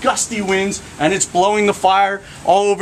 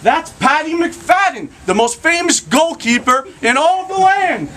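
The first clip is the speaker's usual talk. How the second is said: high shouted announcement; excited yell from the crowd; 12 semitones higher than usual. Acted. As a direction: call out to everyone that the star is here.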